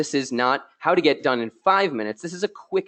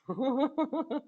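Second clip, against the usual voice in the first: soft laugh; 12 semitones higher, 9 dB quieter than usual. Real, not acted. Reacts to viewer joke.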